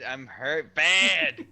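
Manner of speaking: a hammed-up wounded groan; mock hurt. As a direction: drag it out like you're hurt. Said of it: "now I'm doing Sonny's voice".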